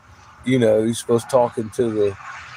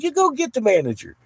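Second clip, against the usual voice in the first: defiant retort; 12 semitones higher, faster than usual. Retold or imitated. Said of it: "I said".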